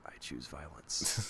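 low, slow, hushed menace; quiet. Retold or imitated. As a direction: say it softly after a pause. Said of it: "just so quietly".

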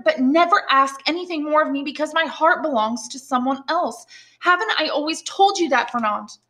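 high-pitched, insistent heroine voice; exasperated young woman. A narrator's reading. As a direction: voice a young woman firmly turning down a suitor.

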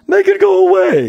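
very high, loud frantic yell. A narrator's reading. Man desperate to lose the hair, frantic yelling. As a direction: yell it in high-pitched panic. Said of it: "yelled the man".

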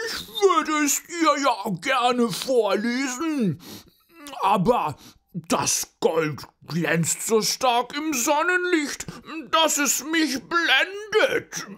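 sehr hoch, laut und langsam gejammert; eitler Pudel. Acted. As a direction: angeberischer Pudel, übertrieben klagend. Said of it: "Darum sagte er". (eitler Pudel, sehr hoch)

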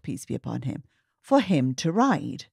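usual speaking voice

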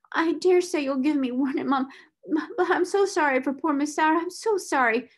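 high-pitched, sorrowful outpouring; tearful. A narrator's reading. Scullery maid grieving for poor Miss Sarah, tearful. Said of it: "She burst into fresh tears".

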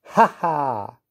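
storyteller's mock laugh; spoken laugh. A narrator's reading. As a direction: crow a teasing ha-ha. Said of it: "laughed Percy".

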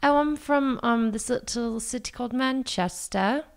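put-on Manchester shop assistant, British accent. Salesperson telling a customer their home town, British accent. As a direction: answer as a Brit from Manchester. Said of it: "try to do an accent".

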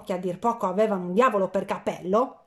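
plain reading voice

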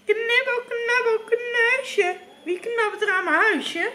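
high-pitched witch's singsong rhyme; creaky witch voice. A narrator's reading. Witch to nibblers at her house, creaky high voice. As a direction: call out high as the old witch. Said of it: "Een krakerige stem zei".

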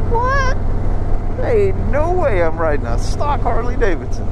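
a high yelped what, then an amazed rush; mock stunned rider; retold disbelief. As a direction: replay the shock of the throttle. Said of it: "It's like".

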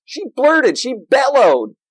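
blurted high and loud, drawn out; bellowed out. Shown as a demonstration. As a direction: bellow it like an animal call. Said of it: "The word here is used for animal sounds".

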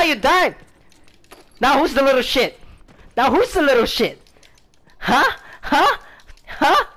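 high, loud crowing; gloating taunt. Real, not acted. Player mocking the enemy who died, loud gloating. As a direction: crow over the fallen enemy.

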